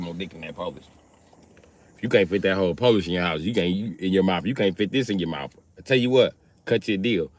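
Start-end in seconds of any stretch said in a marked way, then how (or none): none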